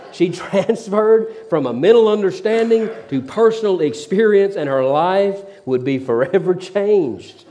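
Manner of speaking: can't stop laughing at the punchline; laughing through words. Real, not acted. Preacher still laughing at his own joke to the crowd.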